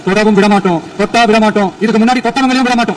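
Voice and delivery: loud oratorical pledge, rising in pitch; emphatic warning to opponents; defiant vow. Real, not acted. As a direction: build the repeated vow with force. Defiant political vow in assembly.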